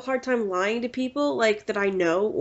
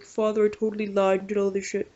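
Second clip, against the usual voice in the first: lightly disguised offhand penitent; a hint of a put-on voice; disguised confession. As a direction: rattle off a careless mock confession. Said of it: "modify your voice if you wanted".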